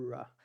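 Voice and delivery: RA com o R inglês prolongado; som do R. Shown as a demonstration. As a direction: hold the English R into RA. (RA puxando o R)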